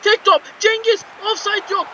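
high, loud urgent build-up; will the ball into the net; rising excitement. Real, not acted. Gamer urging his striker through on goal. Last-minute attack building.

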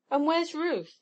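put-on excited boy asking eagerly; excited demand. A narrator's reading. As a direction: demand to know, pitch lifted. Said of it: "demanded the excited Tom".

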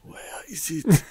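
unvoiced whisper, slow. Podcast cohost jokingly whispering the question back. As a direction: whisper it slowly like a secret.

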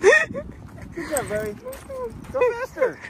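high excited cheering; hoarse marathon cheering. Real, not acted. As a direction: cheer like at a finish line. Cheering dog running circles.

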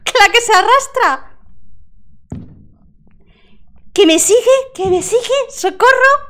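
high panicked yelling; alarm shout. Real, not acted. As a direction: cry out in real fright. Ghost following her.

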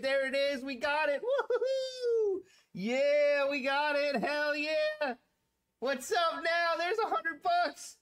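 real overjoyed whooping; ecstatic. Real, not acted. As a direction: shriek with delight at the win. Hits a hundred dollar win.

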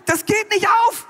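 high-pitched, worked-up griping; hammed-up indignant rant; exasperated complaint. Eve complains to God about her man.

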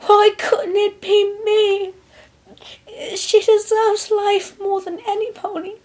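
high, drawn-out helpless wail; acted high-pitched weepy lament; helpless sobbing. A narrator's reading. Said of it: "helplessly sobbed".

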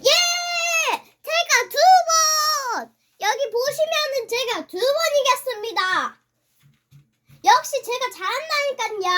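high, loud gloating cheer; triumphant. Real, not acted. Player boasting of a second win, triumphant. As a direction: celebrate at full pitch like a champion.

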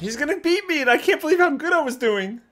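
high loud race panic; excited. Real, not acted. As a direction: yelp it as he pulls ahead. Racing, losing the lead.

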